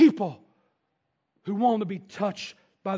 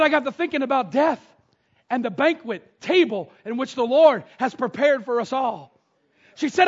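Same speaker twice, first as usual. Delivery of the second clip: building fervent testimony; 5 semitones higher, 9 dB louder than usual. Retold or imitated. Sixty-year-old lady telling her pastor about her fork, growing loud. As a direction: let her faith swell until the last words ring out.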